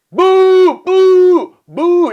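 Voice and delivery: loud high drawn boos; imitated crowd boos; crowd booing. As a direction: boo like a whole room.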